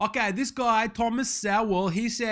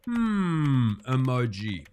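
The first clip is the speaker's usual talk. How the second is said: drawn-out hum, then low; pondering, falling hum; deadpan typing aloud; 8 semitones lower, much slower than usual. Acted. Reading out the emoji he types.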